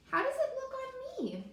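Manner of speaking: high, eager, showing off the hat; turtle asking. Acted.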